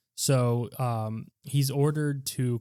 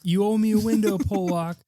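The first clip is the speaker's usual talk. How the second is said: higher and louder cocky taunt; hammed-up tough guy; 8 semitones higher, 5 dB louder than usual. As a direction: throw the line out like a smug killer. Repeats the hitman's line, hamming it.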